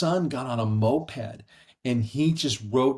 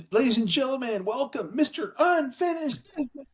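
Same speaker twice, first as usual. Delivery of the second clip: high announcer voice introducing a guest; imitating the emcee's welcome; mock announcer; 13 semitones higher than usual. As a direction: hype it up like a ceremony emcee.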